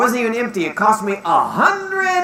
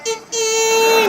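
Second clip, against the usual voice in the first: high squeal; 12 semitones higher than usual. Acted. Puppet squeals at dad.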